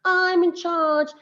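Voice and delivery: high, drawn-out attention-getting call; loud, projected voice. Shown as a demonstration. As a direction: project it slowly to grab the class's attention. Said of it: "needed to use a loud, projected voice".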